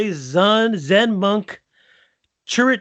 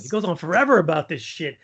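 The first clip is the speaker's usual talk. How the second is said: ordinary delivery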